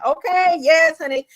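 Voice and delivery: loud, high, hyped-up approval; excited. Real, not acted. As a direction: cheer them on, sassy and loud. Hyped reaction to the fan.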